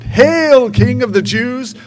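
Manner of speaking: loud, high jeering cry of the soldiers; mocking taunt. Soldiers loudly jeering at Jesus in mock homage. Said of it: "they taunted".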